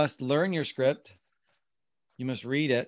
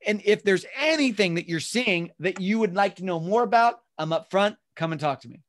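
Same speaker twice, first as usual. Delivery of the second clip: higher, louder, chatty sales pitch; customized, own words; 5 semitones higher, 5 dB louder than usual. Shown as a demonstration. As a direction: riff on it casually in your own words. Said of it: "customization means I'm using the script".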